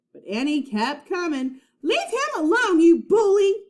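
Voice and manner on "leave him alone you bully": high, loud angry shout; angry cry. A narrator's reading. Annie yelling at the pirate bully, loud angry cry. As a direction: yell at the pirate. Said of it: "she cried".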